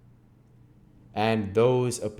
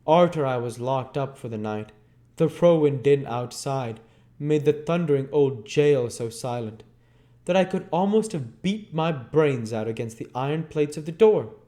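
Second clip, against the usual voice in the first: a convict's voice, raised and higher; voicing a rough Londoner recalling jail; working-class man's voice; 7 semitones higher, 5 dB louder than usual. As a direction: voice the old lag telling his tale. Character voice in Oliver Twist reading.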